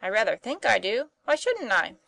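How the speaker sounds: put-on bewildered boy. A narrator's reading. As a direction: fire off puzzled questions on waking. Tom wakes bewildered, acted by narrator.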